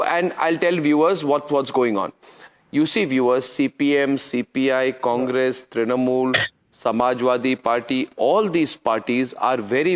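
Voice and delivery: low-pitched, deliberate explanation; measured, low anchor's aside; confiding to viewers. Real, not acted. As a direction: drop the pitch and lay it out for the viewers. Anchor turns aside to brief viewers.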